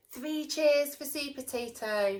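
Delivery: loud cheering shout. Acted. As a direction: lead the cheer. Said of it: "shouted Broccoli".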